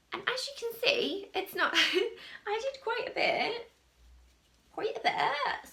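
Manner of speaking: high, playful West Country burr; West Country accent. Pretend. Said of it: "that was my West Country accent coming through".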